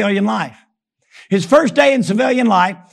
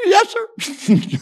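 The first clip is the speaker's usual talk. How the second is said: meek recruit reply; 10 semitones higher than usual. Retold or imitated. Recruit obeys.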